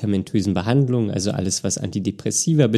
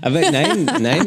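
laughing; 7 semitones higher than usual. Real, not acted. Laughing denial.

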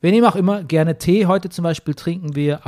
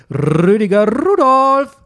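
slow, loud, higher showman announcement; announcer voice; 7 semitones higher, 8 dB louder, slower than usual. Acted. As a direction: call the name out like a ring announcer. Host announces guest like a showman. (langsame, laute Ansage wie ein Showmaster)

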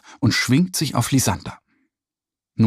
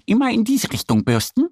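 high duck voice giving instructions; explaining little duck; 11 semitones higher than usual. A narrator's reading. Annie teaching Mo to brush the horse, high duck voice. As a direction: show the bear how it is done. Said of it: "erklärt sie Mo".

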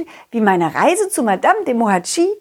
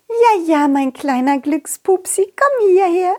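princess voice; 7 semitones higher than usual. Acted. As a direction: coo to the pet as the princess. Princess calls her pet.

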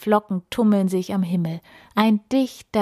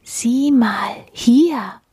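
whisper; 5 semitones higher, 4 dB louder, much slower than usual. A narrator's reading. Papa shows the child the deer, whispering. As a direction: whisper so the deer won't hear. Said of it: "dann flüstert Papa".

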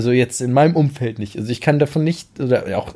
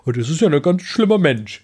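mock outrage; 3 semitones higher than usual. Mocking what people would say.

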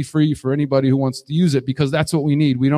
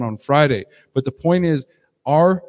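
ordinary delivery

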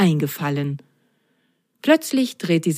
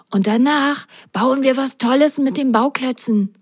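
ordinary delivery

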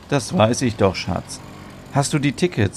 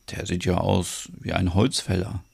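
softer aside, under the breath; whispering; 5 semitones lower, 8 dB quieter than usual. Acted. Ole quietly telling a friend the farmer looks like a lumberjack. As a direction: murmur it so the farmer won't hear. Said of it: "Ole flüstert".

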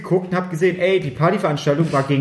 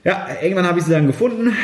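ordinary delivery

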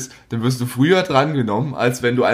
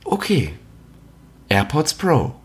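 phone menu voice; 2 semitones higher, slower than usual. Retold or imitated. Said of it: "einfach nur AirPods Pro sagen".

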